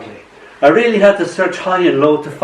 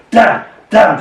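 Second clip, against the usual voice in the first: two loud, higher-pitched cursing bursts; shouting; 7 semitones higher, 6 dB louder than usual. A narrator's reading. Poet voices the wall as the dog takes the ball, shouting. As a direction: shout it sharply as the thwarted wall. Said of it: "shouts the wall".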